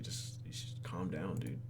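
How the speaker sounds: soft whisper to herself; whispered aside. Real, not acted. Player telling herself to calm down, whispering. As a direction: breathe it under your breath. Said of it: "whispered aside".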